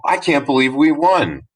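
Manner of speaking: high, thrilled cry of victory; excited disbelief. Shown as a demonstration. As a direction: burst out with joy at winning. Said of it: "That's exciting".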